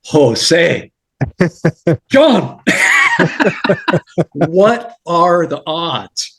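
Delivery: laughing in disbelief; stunned, high, slow delight; amazed, laughing surprise. Retold or imitated. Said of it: "I'm like".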